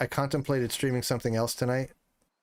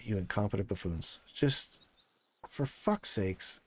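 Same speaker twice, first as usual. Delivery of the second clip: muttered disgust; 2 semitones lower, 6 dB quieter than usual. Real, not acted. Streamer fed up with game.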